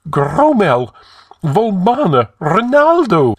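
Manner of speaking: loud, very high anguished cry; exclaimed. A narrator's reading. High Counselor wringing his hands among the corpses, exclaiming. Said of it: "exclaimed Publius".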